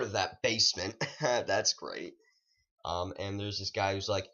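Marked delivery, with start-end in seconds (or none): none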